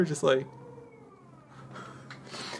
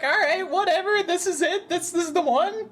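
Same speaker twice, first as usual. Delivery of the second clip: much higher, half-asking; a hammed-up girl shrug; high mock voice; 11 semitones higher than usual. Gamer mocking himself settling on the much higher voice. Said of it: "just be like".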